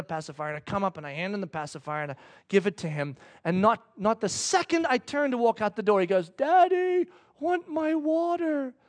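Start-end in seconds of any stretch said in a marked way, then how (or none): 6.3-8.7 put-on high, drawn-out little-kid plea; imitated pleading toddler; toddler son. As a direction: whine slowly like a small child. Toddler son calls to dad.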